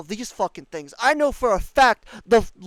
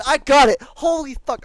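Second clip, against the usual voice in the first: louder, triumphant I got it; sudden loud triumph; triumphant burst; 4 semitones higher, 6 dB louder than usual. Real, not acted. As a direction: blurt it out in relief. Completes hard bounty.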